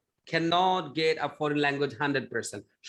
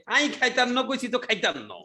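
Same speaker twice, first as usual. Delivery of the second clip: Bangla in a Noakhali dialect, pitch raised; Noakhali accent; 5 semitones higher than usual. Shown as a demonstration. Teacher mimics a Noakhali speaker for the class, in Noakhali dialect. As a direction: mimic a Noakhali villager refusing food. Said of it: "নোয়াখালীর মানুষ তো সে স্টাইলে বাংলা বলছে".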